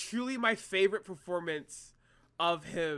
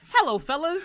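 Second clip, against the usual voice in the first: imitating greeting; 9 semitones higher, 8 dB louder than usual. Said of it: "Craig's like this".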